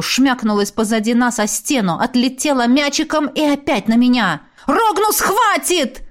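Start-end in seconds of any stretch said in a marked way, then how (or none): 4.7-6.0 growled shout. Acted. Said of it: "зарычал я".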